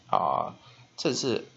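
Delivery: usual speaking voice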